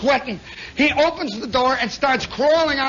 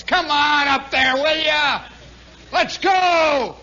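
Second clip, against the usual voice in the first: louder, higher impatient yelling from the car behind; impatient holler; 6 semitones higher, 4 dB louder, slower than usual. Retold or imitated. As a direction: yell at the slow car ahead. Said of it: "he hollers".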